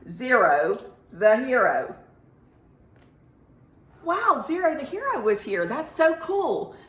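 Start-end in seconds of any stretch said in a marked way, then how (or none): 4.0-6.7 put-on high, thrilled kid-show wonder; bright excited wow for the class; excited gasp. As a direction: gasp it out like the hero just flew by. Teacher reacts to hero sighting.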